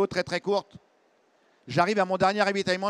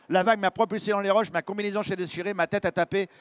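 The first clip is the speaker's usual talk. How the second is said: usual speaking voice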